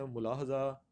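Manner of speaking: ملاحظہ with short u, mu-lahza; common misreading. Shown as a demonstration. Teacher quotes how some read it, demonstrated mispronunciation. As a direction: open ملاحظہ with mu-. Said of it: "کچھ اس کو پڑھتے ہیں".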